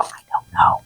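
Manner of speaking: whispered, helpless shrug; clueless whisper. Retold or imitated. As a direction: breathe it out like you're lost. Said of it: "I'm all".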